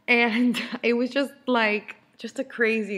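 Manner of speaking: slow words through a laugh. Real, not acted. Laughing about the crazy hospital day.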